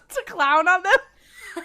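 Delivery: high, slow laughing question. Real, not acted. Podcaster echoing co-host's joke about the characters, laughing. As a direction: repeat it through real laughter.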